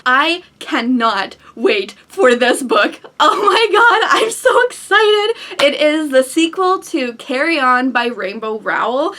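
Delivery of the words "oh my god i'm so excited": high, louder excited gush; real excitement. Real, not acted. Book vlogger thrilled about an awaited sequel, excited.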